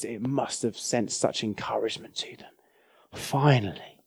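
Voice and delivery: hushed, breathy whisper of relief. Acted. Preacher to congregation, imagining the people's relief, whispered. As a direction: breathe it out like a secret.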